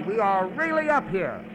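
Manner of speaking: normal talking voice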